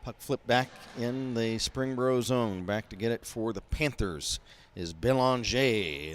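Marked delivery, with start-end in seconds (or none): 4.9-6.1 Belanger said the French way, bel-on-ZHAY; French pronunciation. Real, not acted. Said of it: "that is a French pronunciation".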